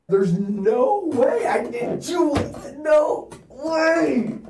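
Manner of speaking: slow, high, voice breaking; sobbing disbelief; crying. Real, not acted.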